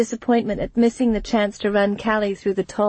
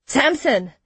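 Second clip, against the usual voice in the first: raised-pitch call of a name; higher, insistent hail; 6 semitones higher than usual. A narrator's reading. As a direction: call to him urgently. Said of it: "Jaren called to him".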